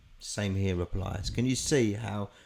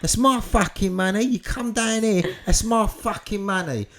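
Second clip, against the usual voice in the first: loud, high mock outrage; hammed-up angry demand; indignant shouting; 11 semitones higher, 10 dB louder than usual. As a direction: yell possessively, easing slightly as it goes. Acted claim over the money.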